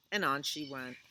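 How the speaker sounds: usual speaking voice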